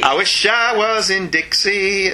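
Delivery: slow, badly faked Southern drawl; Southern accent. Shown as a demonstration. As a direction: draw out a mock Southern accent. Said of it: "does that get you in a Southern accent".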